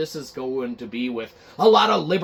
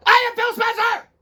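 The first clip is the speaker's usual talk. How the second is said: much higher, louder self-important boom; booming Phil Spencer; 5 dB louder than usual. Acted. Phil Spencer booming his name at a scared staffer, high and loud. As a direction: proclaim your own name like a god. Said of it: "We're gonna do a little reenactment".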